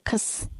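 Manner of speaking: खस breathed with a rough kh, almost silent; खस said softly as a throaty kh-s cluster; German sound. Shown as a demonstration. Teacher models the German chs sound खस for the kids. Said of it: "pronunciation रहता है".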